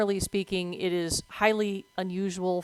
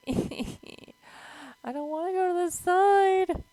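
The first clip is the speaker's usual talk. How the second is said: nervous whine; 12 semitones higher, much slower than usual. Real, not acted. Stuck in game, nervously whining.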